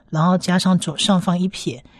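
ordinary talk, nothing marked

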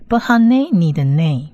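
usual speaking voice

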